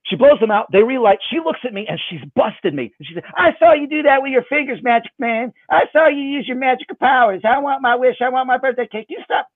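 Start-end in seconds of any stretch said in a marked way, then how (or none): 3.3-9.5 put-on high outraged little-girl voice; a kid who caught him red-handed; indignant child. Sarah catches him cheating.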